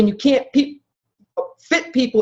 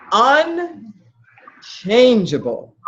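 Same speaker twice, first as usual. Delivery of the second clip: "Un... changeable", very slow, split after "Un"; heavily drawn-out emphasis; much slower than usual. Real, not acted. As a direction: hammer home "Unchangeable" with extreme slowness. Drives the key word home.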